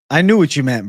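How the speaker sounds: ordinary talk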